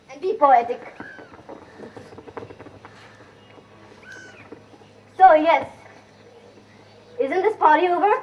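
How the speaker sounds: loud, projected calls across the room; calling out loud. Acted. Actress calling loudly to get a balcony set. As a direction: shout to be heard over the party. Shouting over the party.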